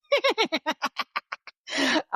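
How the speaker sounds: bright high giggle; laughing after impression. Real, not acted. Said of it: "Okay, that's my best Auntie Nita".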